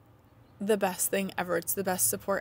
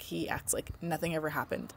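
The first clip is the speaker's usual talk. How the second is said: whisper; 5 semitones lower, 7 dB quieter than usual. Real, not acted. Mom aside about son.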